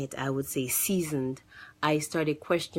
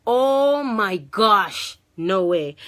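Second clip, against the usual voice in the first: shocked realization; 4 semitones higher, 7 dB louder, much slower than usual. Retold or imitated. Sudden realization driving to church.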